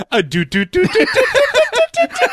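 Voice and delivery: high do-do-do eye-tracking noise; dotted-line sound effect. Retold or imitated. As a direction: vocalize eyes sliding down, laughing. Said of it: "You actually made a dotted line".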